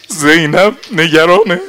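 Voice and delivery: نگرانه cried out high and loud; anguished outcry. Acted. Nafe crying that Zeinab is worried, loud. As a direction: wail زینب نگرانه at full voice.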